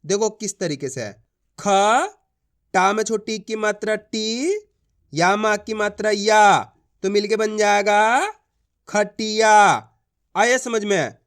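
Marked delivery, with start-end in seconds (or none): 1.6-9.5 ख, टी, या said one by one, then joined into खटिया; sounding out a word. Shown as a demonstration. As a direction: build खटिया from its letters. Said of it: "मेरा तरीका देखना है".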